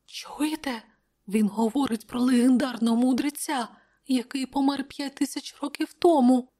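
hushed start, then high strained murmur; furtive whisper. A narrator's reading. Courtiers murmuring about the king's words, furtive whisper. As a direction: gossip in a high, secretive voice. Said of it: "крадькома шепотіли одне одному придворні".

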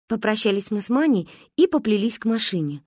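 usual speaking voice

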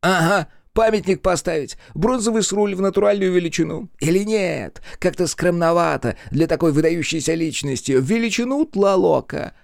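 high-pitched, gleeful mockery; mock the idea of a monument, high and lively; jeering. A narrator's reading. Joker scoffs at honouring the dead, jeering. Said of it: "продолжал глумиться Эрик".

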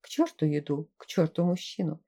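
ordinary delivery, nothing marked

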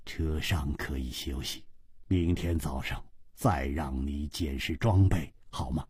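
very low, soft understatement; cool, indifferent. Acted. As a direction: say it quietly, deep in the voice. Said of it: "淡淡的说".

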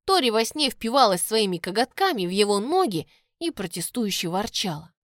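ordinary delivery, nothing marked

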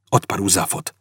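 ordinary delivery

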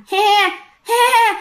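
high, eerie breathy giggle; a spine-chilling little ghost laugh; creepy ghost giggle. Retold or imitated. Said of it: "nó cười cái giọng thấy ghê lắm".

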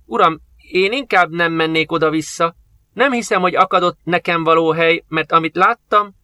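put-on high, timid frightened boy; a scared slave boy shrinking back; pale with fear. A narrator's reading. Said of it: "sápadozott a fiú".

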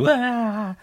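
high, loud frightened yelp; Mikey shrieking at the monster in his face, startled; startled scream. Retold or imitated. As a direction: jump out of your skin with a shriek. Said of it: "Also er schrickt voll".

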